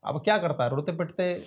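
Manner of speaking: ordinary delivery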